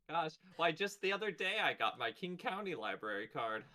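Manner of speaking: high, loud cheesy commercial voice; ad testimonial voice. Pretend. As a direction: sell it like a mock library ad. Mock library ad testimonial.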